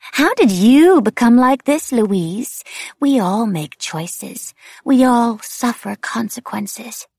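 high, loud singsong, slowing into drawn-out lilts; mocking demented wraith. A narrator's reading. Wraith throws the question back at the narrator, eerie and mocking. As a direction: fling it back high and swooping, leaning on "you".

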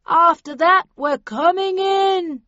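loud, slow call through a megaphone; bullhorn voice. A narrator's reading. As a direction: boom it out over a bullhorn. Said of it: "said the voice into the bullhorn".

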